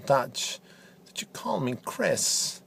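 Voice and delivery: hushed threat; menacing whisper. Acted. Pundit threatening host Dutch in a low whisper. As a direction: whisper the warning coldly.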